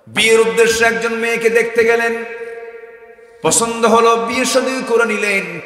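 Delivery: loud, high-pitched sermon narration; full-force preaching. Real, not acted. Preacher telling of the companion's marriage, loud impassioned preaching. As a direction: announce it at full power.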